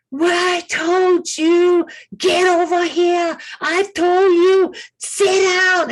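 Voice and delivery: imitating her screaming. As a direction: shout her orders at him, fed up. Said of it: "she was just screaming at him".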